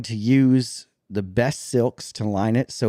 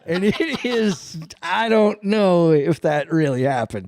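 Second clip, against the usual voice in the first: higher, laughing, slowing down; 6 semitones higher, 4 dB louder than usual. Real, not acted. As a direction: crack up while joking. Joking about moon landing video.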